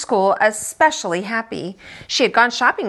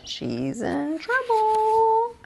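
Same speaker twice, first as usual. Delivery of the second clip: much higher, slow sing-song warning; ominous kid warning; 12 semitones higher, 4 dB quieter, much slower than usual. Acted. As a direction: drag it out knowingly. Friend warning in awe.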